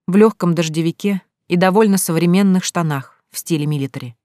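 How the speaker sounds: usual speaking voice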